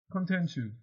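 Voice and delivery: normal talking voice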